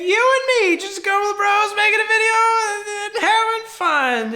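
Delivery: high, strained fake cheer; forced cheerfulness. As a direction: sell the fun a bit too hard. Awkward forced cheer to Dave.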